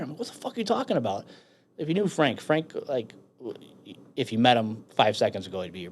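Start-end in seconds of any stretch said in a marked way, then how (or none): 0.2-1.3 high, fast disbelief; incredulous protest. Retold or imitated. Marine told his dead friend is alive, incredulous. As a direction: retell it as a quick, pitched-up protest. Retold disbelief at the kid's answer.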